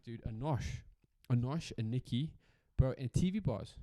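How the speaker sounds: ordinary delivery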